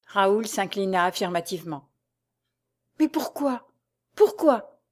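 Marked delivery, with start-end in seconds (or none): 3.0-4.8 higher-pitched, faltering plea; anxious, high repeated question; distressed stammer. A narrator's reading. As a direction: stammer it out in dismay, voice lifted. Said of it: "balbutia la jeune femme".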